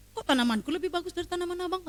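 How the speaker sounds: imitating the neighbour. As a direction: put on the neighbour's blunt tone.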